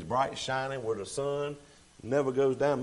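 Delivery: ordinary talk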